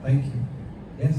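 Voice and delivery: usual speaking voice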